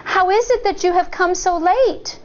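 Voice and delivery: put-on high puzzled little girl; high child voice asking; child's voice. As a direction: ask like a wary child. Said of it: "Shang said".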